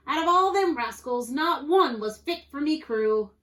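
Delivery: loud, high pirate grumble; exasperated moan. A narrator's reading. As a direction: bemoan the hopeless recruits. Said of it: "Pete moaned to the parrot".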